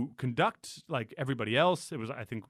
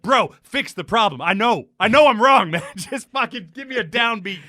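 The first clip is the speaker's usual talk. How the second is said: rising, louder exasperated plea, laughing through it; exasperated plea to conductor; 6 semitones higher, 8 dB louder than usual. Retold or imitated. Singer pleading with a head-shaking conductor, exasperated and loud. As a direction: yell it at the conductor who won't give a downbeat.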